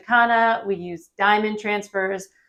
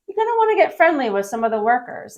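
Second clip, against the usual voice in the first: usual speaking voice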